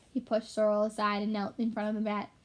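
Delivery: plain reading voice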